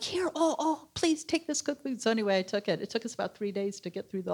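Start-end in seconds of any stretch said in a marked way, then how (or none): 0.0-2.0 eager neighbor. Retold or imitated. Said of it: "And he's like".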